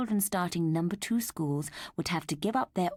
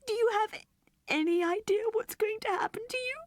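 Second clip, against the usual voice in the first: put-on high frightened child voice; anxious little girl. A narrator's reading. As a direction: ask her teddy in fear.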